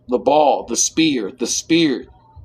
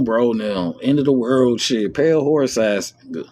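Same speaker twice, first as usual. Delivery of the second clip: ordinary talk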